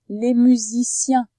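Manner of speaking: "musiciens" loud, slow and fully articulated; emphatic articulation. Shown as a demonstration. Teacher presents the next word, "musiciens" slow and loud. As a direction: draw out "musiciens" firmly. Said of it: "Dans la troisième syllabe".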